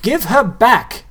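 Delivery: put-on high, loud, slow boy's yell. A narrator's reading. Boy yells at ghouls holding the girl, loud yell. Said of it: "Nobody yelled".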